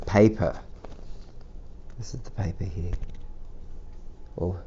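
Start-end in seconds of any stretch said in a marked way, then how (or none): none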